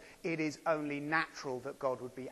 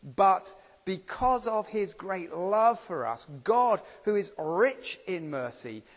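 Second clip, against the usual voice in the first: slow, loud, raised reading of the verse; slow solemn scripture read, louder and higher; 6 semitones higher, 7 dB louder, slower than usual. Acted. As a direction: proclaim each phrase slowly. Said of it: "Let me read just the beginning".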